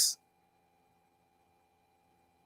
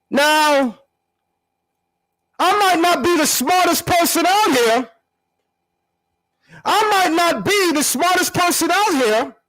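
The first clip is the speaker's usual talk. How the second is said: mock voice; 11 semitones higher than usual. Mocking shift after impression.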